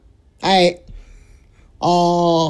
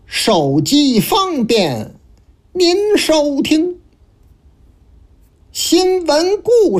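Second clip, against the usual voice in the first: slow, measured recitation with long pauses; rhymed opening verse; 4 semitones higher, slower than usual. Acted. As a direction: chant the opening couplets like a storyteller. Host chants opening verse slowly. (慢速吟诵开场词)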